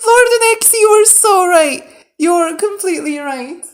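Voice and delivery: high, loud, elated admission; excited concession. Real, not acted. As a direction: cry it out in amazed agreement. Excitedly conceding someone was right.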